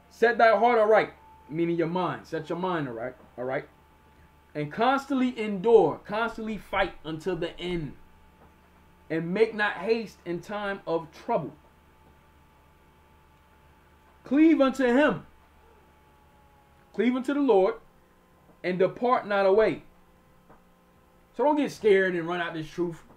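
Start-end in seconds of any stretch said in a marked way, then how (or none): 0.1-1.1 loud, raised proclamation of a verse; high, forceful Bible reading; scripture reading. Acted. Reads scripture aloud. 4.5-19.8 slow, emphatic Bible proclamation; forceful, measured preacher reading; scripture reading. Acted. Reads scripture aloud.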